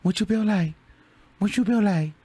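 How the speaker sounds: high, cool mimicked challenge. Fondly mimicking the rapper's challenge, high and even. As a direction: repeat it in a light imitation. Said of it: "shout out to [name]".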